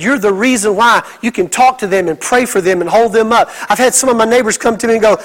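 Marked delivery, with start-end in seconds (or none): none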